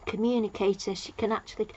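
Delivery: ordinary talk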